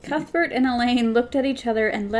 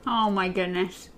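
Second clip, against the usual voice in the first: ordinary delivery, nothing marked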